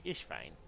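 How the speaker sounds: low "Ish fine", s slurred to sh; imitated lisp. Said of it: "I'll go up to her and I'll say".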